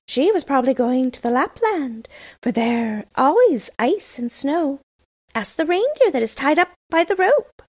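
high, loud bird voice with cooing calls; cooing wood pigeon. Acted. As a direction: chirp it out like a pigeon in a cage. Pigeons answer Gerda.

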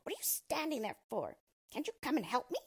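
mild scream. A narrator's reading. Dwarf to two girls, stuck by his beard, a mild scream. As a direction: screech a little, impatient for help. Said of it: "with his fiery red eyes and screamed".

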